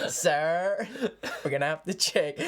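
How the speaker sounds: guard's line broken by laughter; laughing through a stern guard; laughing mock guard. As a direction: say it stern but giggling.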